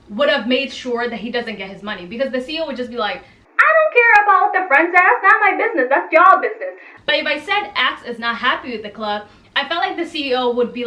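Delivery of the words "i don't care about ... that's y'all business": loud dismissive boss voice, higher; mock CEO rant. Imagined CEO dismissing the friends.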